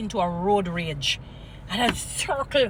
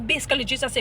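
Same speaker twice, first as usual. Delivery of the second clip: ordinary talk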